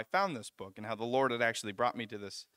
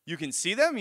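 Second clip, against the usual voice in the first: mildly freaked-out imitation, raised pitch; freaking out; 5 semitones higher, 5 dB louder than usual. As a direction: retell a housemate's alarmed disbelief, louder and higher. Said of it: "he starts freaking out".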